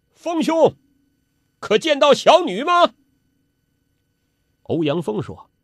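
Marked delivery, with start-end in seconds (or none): 0.1-2.9 high, raised hailing voice; calling out loudly. A narrator's reading. Father hailing a ship about his daughter, calling out loudly. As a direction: call across the water to another ship. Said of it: "高声叫道".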